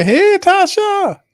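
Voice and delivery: very high and slow hey Tasha; stretched falsetto greeting. Real, not acted.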